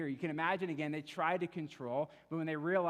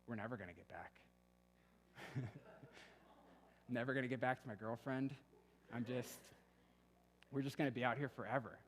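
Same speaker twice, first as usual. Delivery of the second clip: mumbling to himself; 5 dB quieter than usual. Retold or imitated. Tired friend on the bike trip, mumbling gloomily to himself. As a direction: grumble it under your breath, half to yourself. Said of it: "mumbling to himself".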